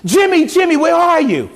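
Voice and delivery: father's frantic call; retold yell. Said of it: "begins to yell at the top of his voice".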